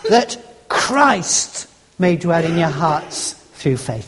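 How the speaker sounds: "Christ" drawn out with weight, the rest slow and falling away; stress on Christ. Shown as a demonstration. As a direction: lean hard and long on the word "Christ". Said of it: "emphasizing a different word each time".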